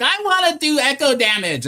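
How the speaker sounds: high-pitched mock toddler whine; toddler temper tantrum. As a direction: throw a hammed-up little kid's tantrum. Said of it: "a toddler with a temper tantrum".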